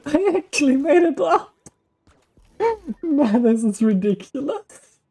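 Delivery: high laughing, breathless relief; relieved laughter. Real, not acted. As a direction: laugh it out, still giddy. Laughing after making the jump.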